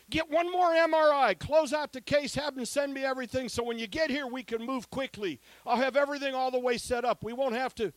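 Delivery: hammed-up doctor laying out the plan; loud doctor's orders. Doctor's orders quoted loudly.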